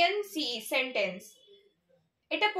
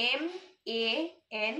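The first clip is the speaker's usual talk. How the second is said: very slow, softer letter-by-letter spelling with long gaps; spelled while writing; 3 semitones lower, 4 dB quieter, much slower than usual. Shown as a demonstration. Teacher writing the word for pupils, spelling slowly. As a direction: spell it out at writing speed, one letter at a time. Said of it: "তাহলে আমি লিখি আগে".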